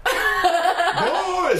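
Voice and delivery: very high laughing mock voice; nasal impression. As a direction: squeal it through laughter. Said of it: "We do some pretty good impressions".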